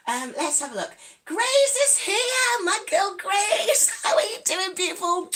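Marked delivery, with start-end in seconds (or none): 1.2-5.3 high, loud, delighted welcome; excited greeting. Real, not acted. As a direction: sing out a hello to a dear friend. Greeting a friend joining the stream.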